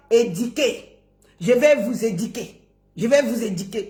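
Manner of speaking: "éduquer" said as "édiquer"; low mock quote, "i" for "u" in "éduquer"; wrong pronunciation. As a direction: mimic the "di" slip in "éduquer". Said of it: "On ne dit pas".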